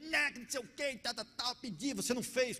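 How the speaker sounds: high, hammed-up nagging complaint; nagging wife. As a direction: imitate a wife rattling off complaints. Imitates wife's nagging complaint.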